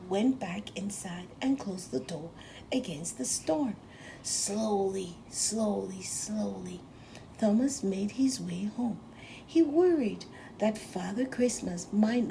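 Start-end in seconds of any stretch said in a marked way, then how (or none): none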